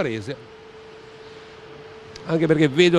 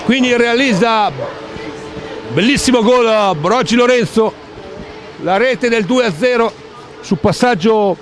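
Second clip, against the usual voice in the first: excited recap. Real, not acted. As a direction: keep the energy after the goal. Post-goal recap, still loud.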